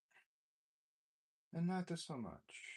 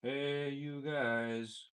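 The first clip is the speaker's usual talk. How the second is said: pedal effect; 6 semitones higher, 7 dB louder, much slower than usual. Real, not acted. Testing mic through the pedal.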